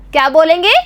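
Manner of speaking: normal talking voice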